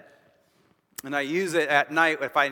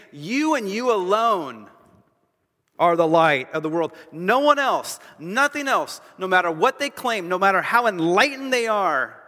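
every word hammered home, loud and higher; emphatic; 4 semitones higher, 6 dB louder than usual. Acted. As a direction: drive each phrase home with full force. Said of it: "he's emphatic with this".

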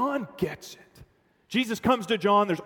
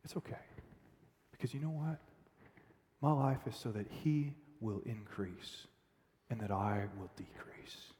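gentle reassurance; 7 semitones lower, 13 dB quieter than usual. Pretend. John calmly reassures his followers, soft and hushed. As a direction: soothe them almost in a whisper.

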